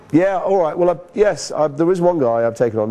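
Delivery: imitating a flustered agent pitching a client; agent back on the phone. Agent acted returning to the caller.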